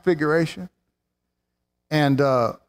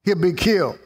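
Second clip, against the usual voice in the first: stern law; 5 semitones higher than usual. Acted. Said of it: "The law said".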